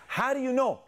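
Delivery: put-on high-pitched kid's challenge; protesting son. Son challenges dad's accusation, high and protesting. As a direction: throw it back at dad in a high voice.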